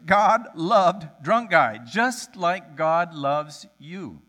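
loud, slow, weighty delivery; slow emphatic. Real, not acted. Preacher driving home his point, slow and emphatic. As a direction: land the point word by word. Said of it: "the hardcore truth".

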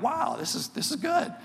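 rising high pleased wow; delighted. Retold or imitated. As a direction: sound happily surprised at how good it is.

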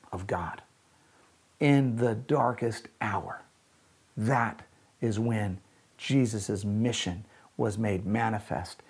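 low, soft, solemn sermon voice; hushed preaching. Real, not acted. Preacher telling the congregation of the darkest hour, hushed.

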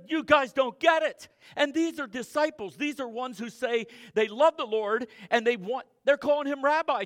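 loud, high-pitched preaching; fiery pulpit preaching; full-force preaching. Acted. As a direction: drive the sermon home to the pews. Preaching to the congregation.

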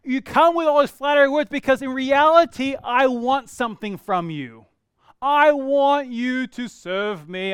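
loud, drawn-out mock demand; emphatic demand. Voicing the flatterer's hidden motive.

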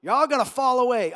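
upbeat crowd greeting building to a loud proclamation; hammed-up pep talk delivery; guest speaker. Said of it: "The one that gets up and goes".

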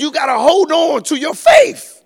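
high, loud call to hold on; shouted exhortation. Acted. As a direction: belt it out at the congregation. Preacher's climax line.

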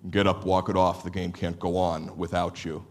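tough-love dad. Retold or imitated. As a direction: lean down and tell him to toughen up. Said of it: "voice needs to lean down and say".

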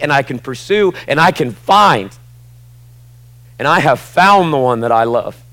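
passionate preaching. Real, not acted. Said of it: "Do you hear the passion now?".

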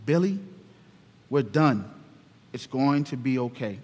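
patient dad murmuring to his son; soft reassuring. Acted. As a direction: quietly talk yourself down, almost under your breath. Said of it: "just in time to hear him say again".

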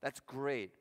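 ordinary delivery

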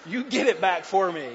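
amused, laughing plea. Real, not acted. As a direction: chuckle out the joking request. Joking about dog in hat.

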